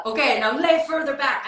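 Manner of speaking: put-on a half-hearted German accent. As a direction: play the German director giving orders in his accent. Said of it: "I can't do a German accent".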